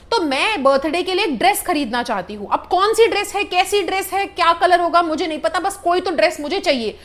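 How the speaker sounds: normal talking voice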